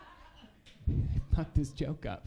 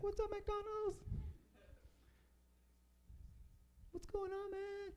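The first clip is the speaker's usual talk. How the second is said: much higher, soft, chummy voice; high goofy voice; 11 dB quieter, slower than usual. Retold or imitated. Comic greeting McDonald's on Facebook in a high goofy voice. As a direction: play yourself as a giddy fan. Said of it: "And I was like".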